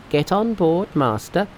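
high-pitched, urging character voice; Monkey. A narrator's reading. Monkey urges his master aboard, high voice. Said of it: "said Monkey".

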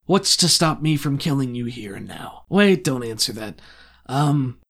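a bored crime lord toying with intruders; villain Strickland. Acted.

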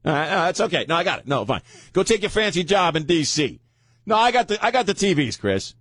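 high, sing-song fake brave protest; playing the wounded sidekick who insists he can cope alone; mock martyr. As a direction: insist you're fine, clearly not fine. Mock martyr refusing help from Chris.

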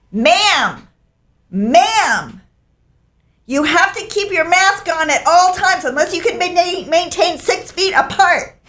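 high, insistent calling out "Ma'am!"; bossy stranger. Retold or imitated. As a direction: nag her like a rule-enforcing hiker. Stranger calling after hiker.

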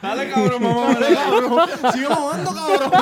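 high, loud hammed-up yelling; mock yelling. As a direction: shout it out in mock passion. Imita gritos en el acto. (gritos exagerados de burla)

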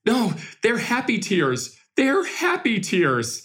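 put-on high, slow, choked-up child voice; a boy laughing through happy tears; imitating a kid with happy tears. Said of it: "He goes".

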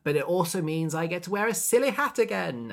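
hammed-up gleeful priest, high and loud; gleeful boast. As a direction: crow like a child about a treat. Acted priest delighting in hat.

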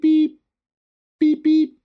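sing-song machine beeping; imitated monitor beep. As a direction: mimic the oxygen alarm going off. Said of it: "it goes beep".

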